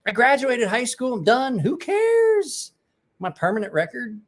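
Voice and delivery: high, loud incredulous rant; exasperated rant. Retold or imitated. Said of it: "And I'm like".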